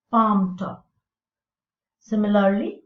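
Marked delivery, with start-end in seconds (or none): 0.1-0.8 palm top with PALM hit louder; stress on first part. Shown as a demonstration. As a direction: punch palm and let top fall away. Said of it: "the stress is on the first part".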